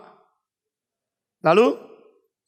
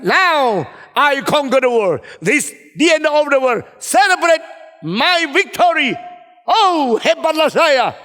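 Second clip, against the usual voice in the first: high, loud victory proclamation, building; triumphant boast; 6 semitones higher, 4 dB louder, slower than usual. Pretend. What the emperor should have said, mock triumph. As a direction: crow like a pretend conqueror.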